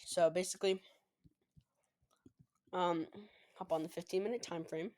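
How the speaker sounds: talking while chewing gum; words around a wad of gum. Real, not acted. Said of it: "Sorry, I'm chewing gum right now".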